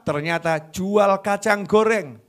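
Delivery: normal talking voice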